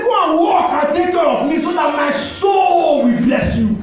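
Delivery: high, slow shouted blessing demand; father's shout. Retold or imitated. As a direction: call it out like the dying father. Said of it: "He said".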